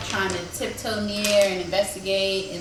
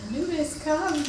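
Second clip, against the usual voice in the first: higher, lightly voiced beckoning; mock dryad call; 8 semitones higher than usual. Player copies the dryad's call to summon her companion, lightly voiced. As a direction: echo the spirit's summons, a bit higher. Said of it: "And I say".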